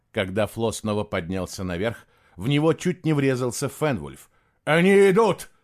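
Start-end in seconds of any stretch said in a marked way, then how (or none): 4.6-5.5 loud, high cry of alarm; shout. A narrator's reading. Винкиин warns the boy they are coming, shouted. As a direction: shout a sudden warning. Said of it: "крикнул Винкиин".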